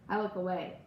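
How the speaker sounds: ordinary delivery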